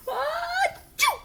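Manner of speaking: high, loud achoo; loud sneeze. Acted. Gerald sneezing near Piggy, loud achoo. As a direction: let the sneeze burst.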